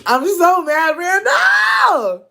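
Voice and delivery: high, loud cry of exasperation; shrieking, laughing exasperation; mock-outraged wail. Real, not acted. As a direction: wail the name in comic outrage. Mock outrage at Randall's story.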